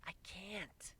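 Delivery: reluctant mare voice; strained. A narrator's reading. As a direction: hold back reluctantly. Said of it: "gave a strained expression".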